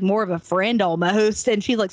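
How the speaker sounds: normal talking voice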